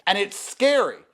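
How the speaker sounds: normal talking voice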